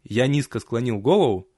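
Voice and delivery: ordinary delivery, nothing marked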